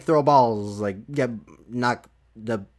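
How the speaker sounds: ordinary talk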